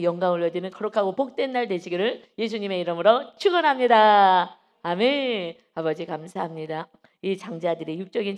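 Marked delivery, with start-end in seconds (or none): 0.0-5.6 rousing benediction. Real, not acted. Preacher blesses the congregation in Jesus' name, loud rousing proclamation. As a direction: swell into a ringing final blessing and amen.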